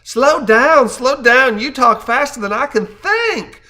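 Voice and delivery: high, loud Southern belle drawl; Southern belle accent. Retold or imitated. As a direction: imitate an exasperated sweet-tea Southern accent. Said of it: "sweet tea accent".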